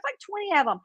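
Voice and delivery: ordinary delivery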